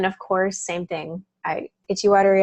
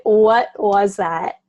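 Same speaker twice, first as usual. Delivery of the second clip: slow, louder amazed question; amazed disbelief; 5 dB louder, much slower than usual. Retold or imitated. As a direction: stretch it out in wonder at the relief. Amazed by sudden relief.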